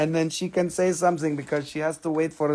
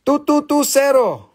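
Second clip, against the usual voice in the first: number called out higher and louder; 7 semitones higher, 4 dB louder than usual. Shown as a demonstration. Seller calling the claim number higher and louder. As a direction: call it out to the room. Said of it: "repeats louder".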